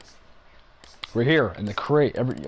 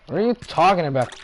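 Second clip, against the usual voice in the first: incredulous; 7 semitones higher, 6 dB louder than usual. Real, not acted. Mock disbelief at chat.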